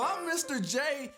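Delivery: ordinary delivery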